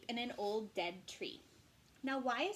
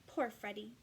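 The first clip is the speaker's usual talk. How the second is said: ordinary delivery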